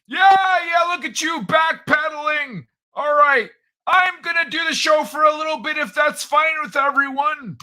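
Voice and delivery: put-on high, loud old-man character voice; Old Man Kai voice. Host ribbing his co-host, as a high, loud old man.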